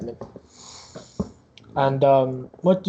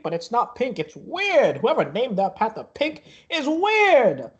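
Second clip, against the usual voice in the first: higher-pitched, rambling showman; carnival barker; 7 semitones higher than usual. Acted. Barker pitching tickets, plushies and prizes to visitors, showy. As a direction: sell the wares with fairground patter.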